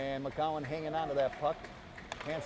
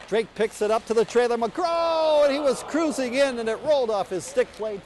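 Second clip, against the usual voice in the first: excited play-by-play; 6 semitones higher, 6 dB louder than usual. Real, not acted. Hockey rush towards goal.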